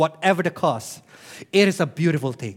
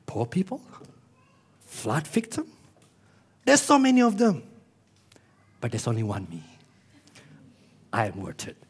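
mock arrogant pastor; 6 semitones lower, 4 dB quieter than usual. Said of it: "And I said".

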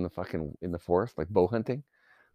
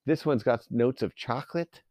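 lightly voiced sommelier; 6 semitones higher than usual. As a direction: stretch the tasting words, a touch pretentious. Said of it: "the guy's like".

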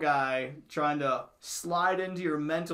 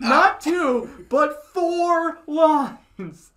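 infomercial shout; 11 semitones higher, 13 dB louder, much slower than usual. Retold or imitated.

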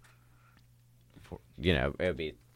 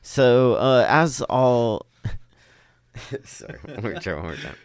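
laughing; 8 semitones higher, 6 dB louder than usual. Real, not acted. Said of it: "You made me laugh".

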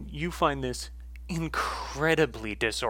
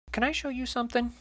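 high, quicker woman's character voice; Martine; 11 semitones higher, faster than usual. Acted. Martine offers to show Essie something, high tentative voice. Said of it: "my voice is gonna be all over the place for her".

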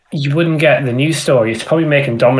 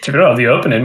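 ordinary delivery

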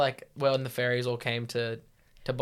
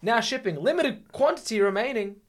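ad announcer; 9 semitones higher than usual. Pretend. Mock product announcement.

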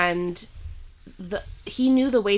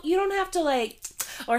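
usual speaking voice

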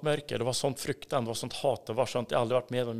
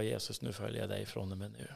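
low, soft, solemn promise; quiet vow; 4 semitones lower, 10 dB quieter than usual. Retold or imitated. New believer answers Jesus, quiet vow. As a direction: lower the voice and commit simply.

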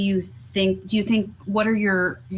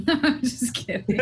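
laughing; 5 semitones higher than usual. Real, not acted. Teasing joke, laughs while speaking.

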